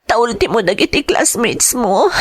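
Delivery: normal talking voice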